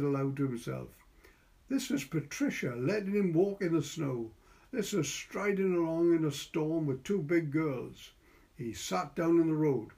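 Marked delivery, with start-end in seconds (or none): none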